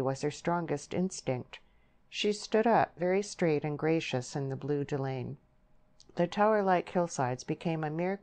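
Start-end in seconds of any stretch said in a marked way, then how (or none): none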